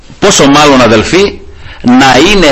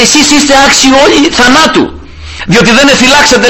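high-pitched mock call, no real shout; retold calling out; 10 semitones higher than usual. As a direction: imitate a challenge called from a distance. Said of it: "άρχισε να φωνάζει ο Δαβίδ". (σαν να φωνάζει από μακριά, ψηλά)

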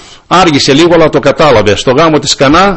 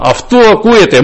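Αυτού ακούεται cried out much higher; emphatic command; 8 semitones higher than usual. Retold or imitated. Preacher repeating the Father's command, ringing high emphasis. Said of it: "Ο Πατέρας είπε".

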